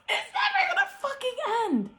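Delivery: high, half-laughing despair; a frantic, high inner wail that it will never end; inner panic. Retold or imitated. To herself on the phone, half-laughing panic. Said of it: "in my head I was like".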